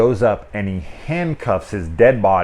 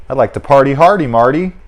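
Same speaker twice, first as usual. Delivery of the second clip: loud, high, fast party cry; hyped quote, louder and higher; party shout; 6 semitones higher, 10 dB louder than usual. Retold or imitated. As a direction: whoop it like a frat guy. Quoting a party line.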